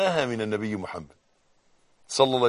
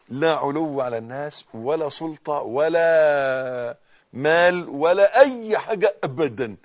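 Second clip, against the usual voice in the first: slow, swelling sermon delivery, ending louder; slow preaching; 8 dB louder, slower than usual. Acted. Preacher to his congregation, slow swelling sermon. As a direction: preach each word slowly, building up.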